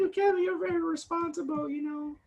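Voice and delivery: high, honeyed sweet-talking coaxing; butter him up in a high syrupy voice; high sugary flattery to get help. Retold or imitated. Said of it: "they try to sweet talk me".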